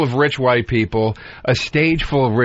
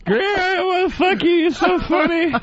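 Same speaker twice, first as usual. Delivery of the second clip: girl impression. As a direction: gush in a silly high girl voice. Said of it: "do your girl impression".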